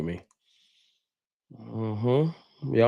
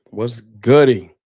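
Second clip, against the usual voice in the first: drawn-out greeting call; 8 dB louder, much slower than usual. Real, not acted. Hype greeting to viewer.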